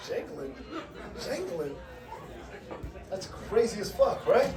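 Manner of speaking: host voices the whole room, hushed; mock audience murmur. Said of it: "Everybody was like".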